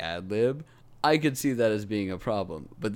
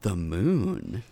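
usual speaking voice

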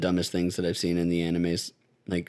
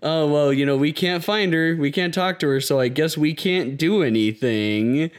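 higher-pitched mock bureaucratic helplessness; mock helpless excuse; 6 semitones higher, 3 dB louder than usual. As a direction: put on a feeble, oh-well shrug in the voice. Mocks officials making feeble excuses.

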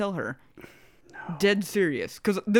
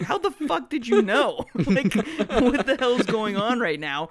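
baffled mock outrage; 5 semitones higher than usual. Viewer baffled by the anime scene, laughing disbelief. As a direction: act stunned, laughing through it. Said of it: "And it's just like".

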